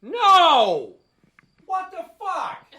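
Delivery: high, loud shocked No; dad yelling in shock at son. Retold or imitated. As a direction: yell it in horror, then stretch it slowly. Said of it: "I'm like".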